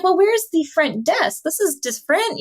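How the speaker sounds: high, bewildered asking; mock-puzzled traveler; puzzled surprise. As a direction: sound lost and confused at the door. Confused guest arriving at strange inn.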